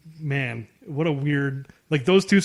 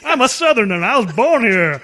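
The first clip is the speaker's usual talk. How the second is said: loud, higher put-on Southern accent; bad Southern accent; 6 semitones higher, 5 dB louder than usual. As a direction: overdo a fake Birmingham, Alabama Southern accent. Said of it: "trying to do a Southern Birmingham accent".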